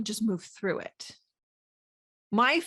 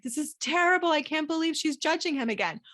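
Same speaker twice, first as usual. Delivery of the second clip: put-on higher, indignant grumbling old theatre critic; grouchy critic; 5 semitones higher than usual. Grouchy Muppet-style critics judging her brain, mock indignation. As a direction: tut at her like a disapproving heckler. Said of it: "the people in the little box".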